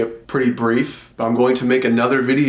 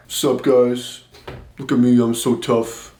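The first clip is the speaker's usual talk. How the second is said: lightly put-on tough-guy swagger; mock tough guy; slower than usual. Mocking guys who act tough, mock tough-guy voice. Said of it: "walk around like the tough guy".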